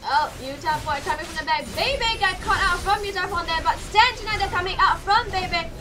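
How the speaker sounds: ordinary delivery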